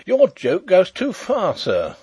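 high, loud indignant outburst; angry cry. A narrator's reading. Woken narrator to Sapt, angry cry. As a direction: cry out in anger, dropping on the last word. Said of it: "I leapt to my feet in anger".

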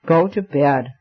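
plain, mildly stern order; stern simplicity. A narrator's reading. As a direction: give the order simply, with a touch of severity. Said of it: "said his friend with a stern simplicity".

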